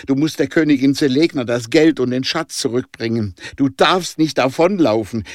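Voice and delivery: high, insistent appeal, easing at the end; urgent pleading. A narrator's reading. Danica reminds Jonah of his promise, urgently pleading. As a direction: plead hard, raising pitch on each question.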